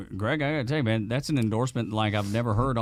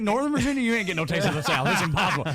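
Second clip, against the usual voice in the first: fast and laughing through the jab; laughing tease; 8 semitones higher, 3 dB louder, faster than usual. Real, not acted. Host teasing the guest about Northern Virginia, laughing.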